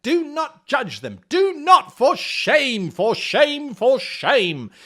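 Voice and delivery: loud, mock-stern for shame; mock scolding. Mock-scolding the audience for shame. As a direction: wag a finger at the room. Scolding players for judging maps.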